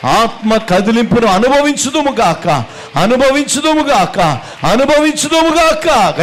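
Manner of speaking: preaching at full force. Acted. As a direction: build the chant to a fever pitch. Preacher's rising blessing chant.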